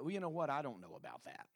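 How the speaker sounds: soft, low reluctant reply; hushed, sinking skepticism; doubtful objection. Acted. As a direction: voice a hesitant doubter, trailing down very low. Voices doubter of God's idea.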